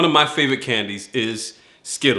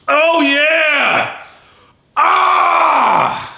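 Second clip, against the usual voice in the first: high, loud triumphant yell; excited shout; 7 dB louder, much slower than usual. Real, not acted. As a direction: whoop with delight at the crunch. Breaks candy with finger.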